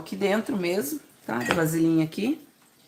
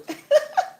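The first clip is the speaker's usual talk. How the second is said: high laugh; 4 dB louder than usual. Real, not acted. Laughs before calling kids.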